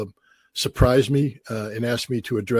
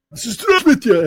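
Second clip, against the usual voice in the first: high, loud confident hello to a crowd; bold public greeting; 11 semitones higher, 6 dB louder than usual. Retold or imitated. A Marine greeting twenty-five thousand Chechen soldiers, loud and high. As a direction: belt the greeting out to a huge crowd.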